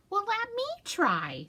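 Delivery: lightly voiced high little mouse; a hint of squeaky mouse; tiny mouse asking to try, lightly high. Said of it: "said Mouse".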